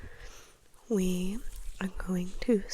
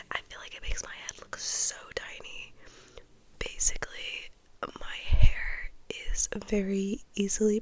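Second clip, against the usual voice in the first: ASMR whisper; 7 dB louder than usual. Real, not acted. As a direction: whisper it close and intimate. Whispers throughout ASMR vlog.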